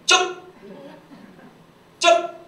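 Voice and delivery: short, high, loud outburst; dialect scolding. Acted. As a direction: snap one explosive syllable at grandma. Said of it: "경상도 손자가 한마디 합니다".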